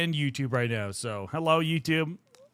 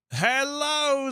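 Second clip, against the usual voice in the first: bright hello; 8 semitones higher than usual. Real, not acted. Greeting a chat member.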